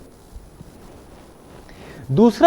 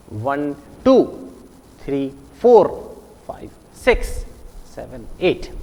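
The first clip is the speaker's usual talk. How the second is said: वन, three, five, seven murmured low, टू, फोर, six, एट punched out; every second number stressed; 5 semitones lower, 4 dB quieter than usual. Shown as a demonstration. Teacher showing pupils skip counting, stressing टू, फोर, six, एट. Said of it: "जोर से बोल रहा हूं".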